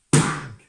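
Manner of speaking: sudden explosive bang; axe-blow bang. Acted. Man swings axe at the cow, sudden loud bang. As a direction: hit it like a falling axe.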